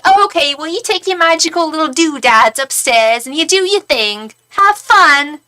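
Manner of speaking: high, mocking Cockney dismissal. Hostile woman sends tarot reader upstairs, mock Cockney. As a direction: imitate a condescending Londoner, loud at the end. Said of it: "She had a really Cockney accent".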